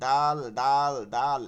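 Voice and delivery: "দাল" loud, with a long held aa; each "দাল" separate and drawn out; letter-name demonstration. Shown as a demonstration. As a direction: sound out "দাল" firmly, stretching the vowel. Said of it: "বানানটা হচ্ছে দাল".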